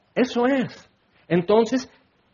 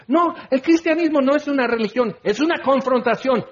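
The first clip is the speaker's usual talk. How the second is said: higher, proud corrected version of the slogan; bold declaration; 7 semitones higher than usual. Shown as a demonstration. Preacher voicing the answer he wants believers to give, bold and high. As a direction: proclaim it with conviction, voice raised high. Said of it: "Entonces, si quieres decir".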